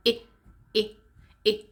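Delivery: "I" as a short ih, as in sit; isolated short vowel "I", with pauses between; short I sound. Shown as a demonstration. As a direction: cut "I" short, each one separate. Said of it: "shorter version of number one".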